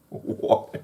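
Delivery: breathless, soft "what" through a laugh; laughing disbelief. Real, not acted. Reviewer baffled by Jacob's undead line, laughing disbelief. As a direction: laugh it out, barely voiced.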